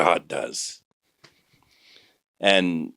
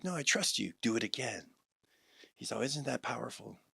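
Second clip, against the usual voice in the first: much softer, steady; softly spoken, calm trust; calm reassurance; 13 dB quieter than usual. Retold or imitated. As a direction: reassure him gently.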